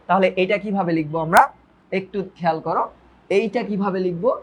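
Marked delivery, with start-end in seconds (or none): none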